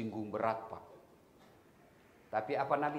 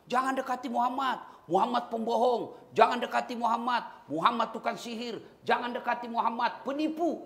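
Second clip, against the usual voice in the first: higher-pitched market crier, imitating jeers; mock shouting blind man; 9 semitones higher than usual. Preacher voicing a blind man yelling insults in the market, mock shouting. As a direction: act out a blind man's street taunts, higher up. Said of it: "Teriak-teriak".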